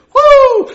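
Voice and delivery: high, loud rejoicing whoop; joyful whoop. Real, not acted. Preacher whoops for joy at the good news. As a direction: let out a joyful holler.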